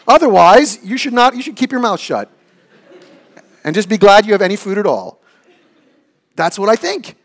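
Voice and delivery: loud, high, hammed-up selfish dad; mock pompous king of the house. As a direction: boom it out like a petty tyrant father. Preacher voicing Ahab-like selfish dad.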